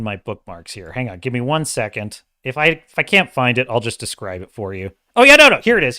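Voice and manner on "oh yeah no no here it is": loud, high burst of relief; sudden discovery. Real, not acted. Streamer finally finds the tweet for viewers, excited. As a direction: blurt it out on spotting it.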